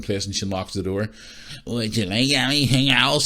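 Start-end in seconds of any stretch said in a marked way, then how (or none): 1.7-3.3 high, slow witchy voice; creepy landlady. Retold or imitated. Landlady offering her guest more, creepy witch voice. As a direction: imitate a creepy witch, pitched up and drawn out. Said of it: "That's the way she sounded".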